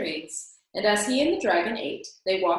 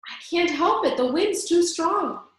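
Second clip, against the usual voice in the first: higher and louder, strained against the wind; dragon screaming; 9 semitones higher, 4 dB louder than usual. A narrator's reading. Tired dragon to Elmer in the storm, screaming. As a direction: cry out that the wind's too strong. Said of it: "screamed the dragon".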